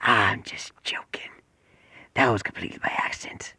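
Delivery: hushed whispered creature voice; creature's whispered dark joke; the Bat. Acted. Creature tells Izuku it was joking, whispered creature voice. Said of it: "then the creature goes".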